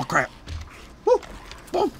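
ordinary talk, nothing marked

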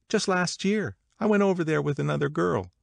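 high, lively gossiping woman; gossipy Catherine. Acted. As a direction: pitch it up like eager party gossip. Voices Catherine's travel gossip.

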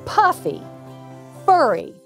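ordinary delivery

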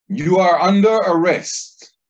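loud, high barked arrest order; police shout. Retold or imitated. Cop at the door shouting at the teller, loud and high. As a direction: bellow it like a cop with a gun drawn.

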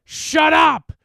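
high, furious bellow; explosive monster outburst; specter's shout. A narrator's reading. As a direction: shout down the chanters. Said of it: "the specter shouted".

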